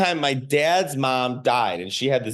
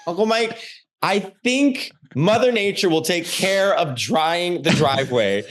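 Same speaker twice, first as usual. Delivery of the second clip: retold scream; 6 semitones higher than usual. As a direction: reenact calling out to an uncle in protest. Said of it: "I screamed".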